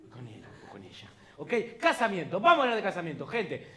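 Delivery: ordinary talk, nothing marked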